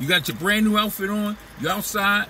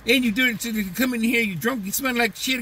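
put-on higher nagging girlfriend voice; lightly pitched-up nagging partner voice; 6 semitones higher than usual. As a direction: scold him like an annoyed partner. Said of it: "This is".

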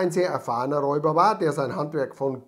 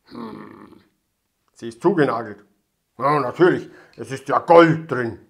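loud gruff robber muttering; boastful robber, loud; 9 dB louder than usual. A narrator's reading. Robber talks to himself. (polternder Räuber mit sich selbst)

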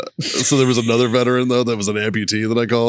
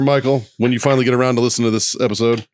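normal talking voice

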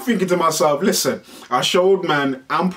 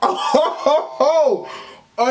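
slow high drawn-out laugh; stretched high oh ho ho; mock roadman laugh; 9 semitones higher, slower than usual. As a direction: hoot slowly like an impressed lad. Roadman reaction voice.

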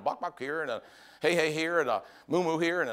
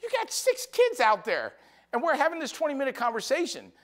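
higher, insistent retelling; urging the dad; 9 semitones higher, 3 dB louder than usual. Retold or imitated. Preacher retelling urging a chatty dad, higher and insistent. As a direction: press the dad to get going. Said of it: "I'm telling the dad".

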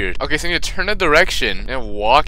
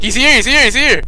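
higher, louder alarmed cry; frightened; 12 semitones higher, 6 dB louder than usual. Real, not acted. Gamer spotting the monster outside, frightened cry. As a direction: blurt it out in alarm.

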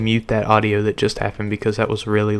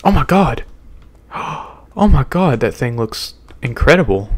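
awestruck; 3 semitones higher, 5 dB louder than usual. Real, not acted. Sees monster chasing.

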